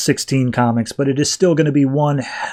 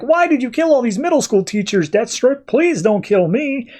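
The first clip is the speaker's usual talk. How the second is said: mock scream; 9 semitones higher than usual. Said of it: "she starts screaming out".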